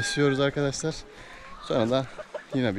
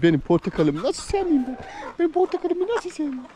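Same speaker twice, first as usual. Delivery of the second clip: squeaky doting pet voice. Real, not acted. Fawning over animal, baby talk.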